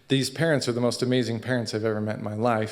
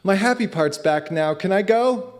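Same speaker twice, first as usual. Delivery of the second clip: put-on cheerful child; 7 semitones higher, 3 dB louder than usual. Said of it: "the son's like".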